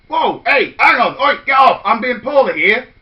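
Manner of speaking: loud, startled wolf fending off hugs; loud get-off bluster; wolf protesting. Acted. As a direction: shove the kids away, flustered. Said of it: "said the big bad wolf".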